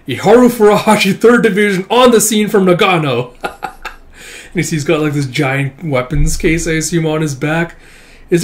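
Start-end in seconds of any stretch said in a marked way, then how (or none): 0.1-3.3 high, loud heroic call-out; loud announcement. Acted. Soldier declaring his arrival on scene, loud call-out. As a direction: announce yourself boldly.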